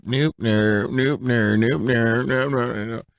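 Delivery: exaggerated nasal honking, repeated evenly; mock nasal. Shown as a demonstration. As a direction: imitate a stuffed-up nose, playfully. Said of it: "not sounding nasally like".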